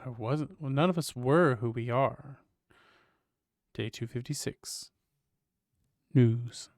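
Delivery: ordinary talk, nothing marked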